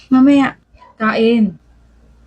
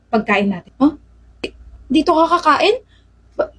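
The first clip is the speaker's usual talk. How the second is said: ordinary delivery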